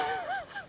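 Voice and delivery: squeaky breathless giggle; high giggle. Real, not acted. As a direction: dissolve into giggles. Laughing at impression.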